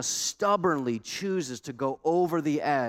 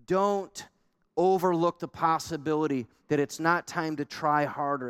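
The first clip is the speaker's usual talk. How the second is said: ordinary delivery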